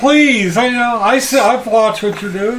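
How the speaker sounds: high eager voice pleading please; eager abductee. Retold or imitated. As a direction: beg eagerly to drive. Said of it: "the abductee goes".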